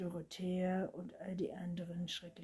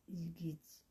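plain reading voice

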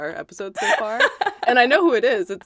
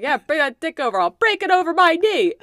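rising to a loud, high threat; tough-talking threat; 10 semitones higher, 8 dB louder than usual. Retold or imitated. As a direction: bark it like a brawler. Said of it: "my response was like".

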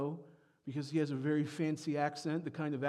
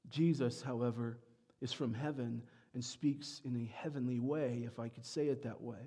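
ordinary talk, nothing marked

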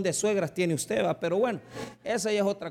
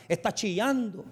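normal talking voice

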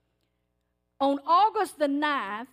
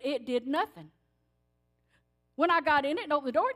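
ordinary talk, nothing marked

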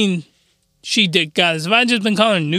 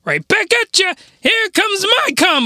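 character voice; 10 semitones higher than usual. Retold or imitated. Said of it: "the Nubian she dick goddess is like".

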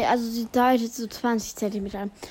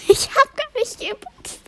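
high laughing protest; 11 semitones higher, 4 dB louder than usual. Real, not acted. Laughs at wrong birthday wish.

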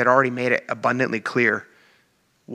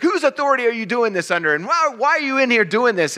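indignant priests; 7 semitones higher than usual. Retold or imitated. Temple leaders voiced challenging Jesus.

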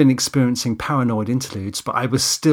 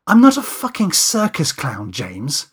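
high, louder offended protest; indignant; 6 semitones higher, 4 dB louder than usual. Acted. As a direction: object hotly, as if your profession were insulted. Kate indignantly rejects the request.

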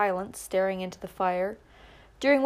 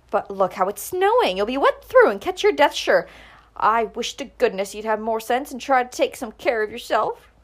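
scolding mother; 4 semitones higher, 7 dB louder than usual. A narrator's reading. Mother scolding about the snow.

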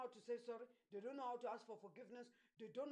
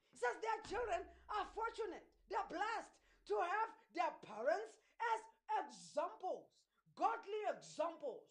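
emphatic preaching; 5 semitones higher, 5 dB louder than usual. Acted. Preacher reads and explains a promise to godly parents, emphatic preaching. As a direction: proclaim it to the congregation.

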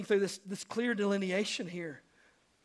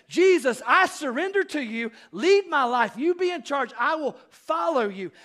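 earnest surrender prayer; 5 semitones higher, 6 dB louder than usual. Acted. To submission to say.

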